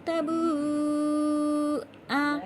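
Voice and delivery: drawn-out Quranic syllables; recitation demo. Shown as a demonstration. Teacher showing students how to read, slow recitation. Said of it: "कैसे पढ़ेंगे?".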